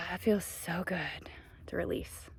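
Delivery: hushed release after a sigh; relieved whisper. Real, not acted. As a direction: breathe it out in a soft whisper.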